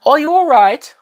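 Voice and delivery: high, loud mock British; overdone British accent, pitched high; bad British accent. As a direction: put on a terrible British accent. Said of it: "That was a horrible British accent".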